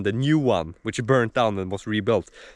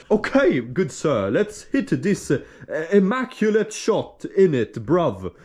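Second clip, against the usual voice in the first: hammed-up posh British accent, pitched higher; mock British accent; 5 semitones higher, slower than usual. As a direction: play a snooty Brit with a monocle. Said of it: "I become British".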